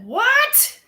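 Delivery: high, loud disbelieving what; stunned rising what; shocked what. Real, not acted. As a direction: squeal it in disbelief. Shocked by a Kate Winslet comparison.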